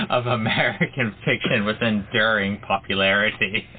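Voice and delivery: ordinary talk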